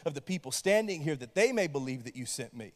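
low, measured Bible reading; scripture reading. Acted. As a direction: read the passage calmly and solemnly. Reads scripture aloud.

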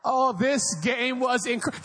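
ordinary delivery

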